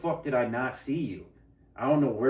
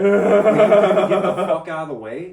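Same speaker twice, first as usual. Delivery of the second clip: laughing rant; 7 semitones higher, 13 dB louder than usual. Retold or imitated.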